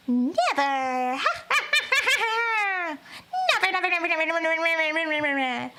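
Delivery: a cackling witch refusing to forgive; evil witch. Acted. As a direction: gloat and chant it over and over. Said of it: "an evil witch kind of situation".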